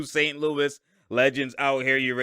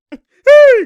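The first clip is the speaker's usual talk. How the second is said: a much higher, louder yelp; excited yelp; 16 dB louder than usual. Real, not acted. Reacting to a tip on replay with a high excited yelp. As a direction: yelp at the play.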